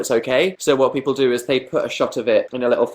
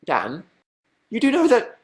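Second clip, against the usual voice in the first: incredulous friends; 6 semitones higher than usual. Retold or imitated. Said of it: "they were like".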